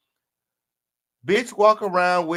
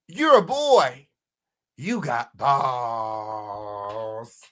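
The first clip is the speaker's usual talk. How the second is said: mock diss, drawn out; 5 semitones lower, much slower than usual. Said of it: "He said".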